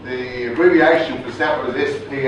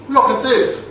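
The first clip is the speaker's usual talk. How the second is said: high, loud posh English command; posh English order, pitched up; toffee voice; 10 semitones higher, 4 dB louder than usual. Retold or imitated. Soldier commanding the MPs in a high posh English accent. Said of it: "he puts on this voice".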